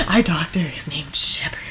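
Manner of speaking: breathless laughing mock whisper. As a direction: giggle the line under your breath. Mimicking mother, laughing.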